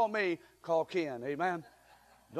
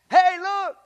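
high, loud call; preacher snapping the room awake; sudden call out; 9 semitones higher, 9 dB louder than usual. Real, not acted. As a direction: grab their attention, hey look. Preacher calling out to congregation.

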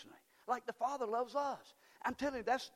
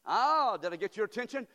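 ordinary talk

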